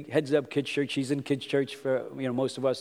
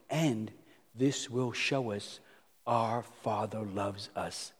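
soft, slow, tender sermon close; hushed preaching; 3 semitones lower, 5 dB quieter, slower than usual. Acted. Pastor reflecting on the Father's pain, hushed and slow. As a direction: draw them in quietly, slowly.

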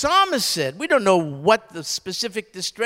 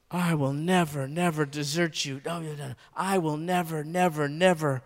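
softer, "never" repeated in a low hush; hushed count of nevers; 5 semitones lower, 6 dB quieter than usual. Shown as a demonstration. Preacher lingering over the five nevers, softly. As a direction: let the promise sink in quietly. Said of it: "five nevers".

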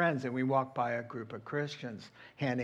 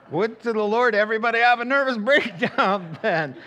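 laughing mockery; 6 semitones higher, 6 dB louder than usual. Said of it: "You know, I let".

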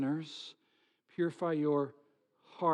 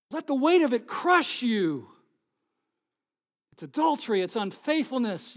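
pleading prophet; 4 semitones higher, 6 dB louder than usual. Acted. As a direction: plead like an Old Testament prophet. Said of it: "taking on the mantle of the Old Testament prophets".

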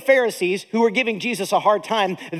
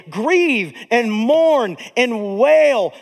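drawn out; 3 dB louder, much slower than usual. Real, not acted. Reading James with weight.

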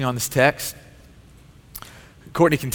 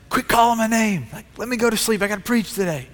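higher, hammed-up annoyed outburst at a child; mock exasperated snap; 8 semitones higher than usual. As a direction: replay your tired midnight temper as a joke. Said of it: "I lose my temper and I'm mad at her".

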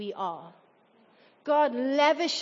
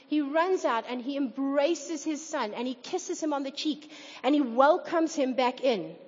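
ordinary talk

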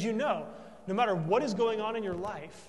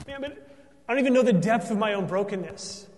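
louder, fast, impassioned preaching; fervent preaching; 5 semitones higher, 9 dB louder than usual. Acted. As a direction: preach it full force from the pulpit. Preaching at full force.

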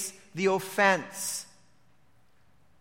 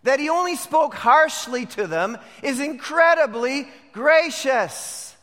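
raised, punchy pulpit delivery; forceful preaching; 7 semitones higher, 6 dB louder, slower than usual. Real, not acted. Preacher denouncing the brothers' claim to honesty, forceful preaching. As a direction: hammer each charge home.

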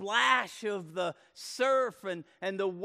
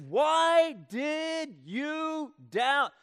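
loud, high, very slow demand; loud rebuke; 9 semitones higher, 6 dB louder, much slower than usual. Acted. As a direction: stretch out each word as a shouted rebuke. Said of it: "but he says".

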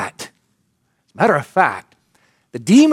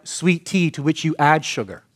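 ordinary delivery

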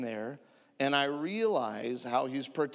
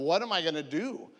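ordinary delivery

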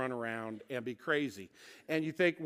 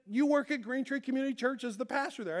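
higher, delighted voice of recognition; big smile; 9 semitones higher than usual. Retold or imitated. Nurse turns round to the pastor, smiling and delighted. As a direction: imitate a smiling nurse, pitched high. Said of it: "she's got a big smile on her face".

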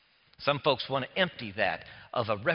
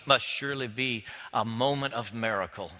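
ordinary talk

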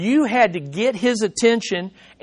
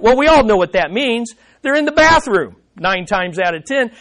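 ordinary delivery

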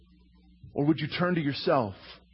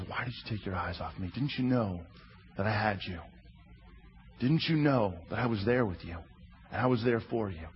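lower, softer and gentle; gentle, quiet; 7 semitones lower, 6 dB quieter than usual. Acted. Jesus gently reassuring Peter in the boat, softer and lower. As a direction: reassure him softly. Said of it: "He simply says".

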